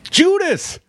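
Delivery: high-pitched, exasperated blurt; exclaimed answer. Real, not acted. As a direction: cry out the obvious answer, pitch shooting up. Blurts the answer after wrong guess.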